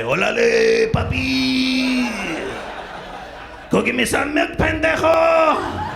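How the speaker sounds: put-on high, loud Mexican-accented mom; Spanish accent. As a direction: yell it like a sassy Spanish-speaking mom. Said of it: "my child speaks Spanish".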